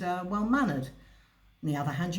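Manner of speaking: normal talking voice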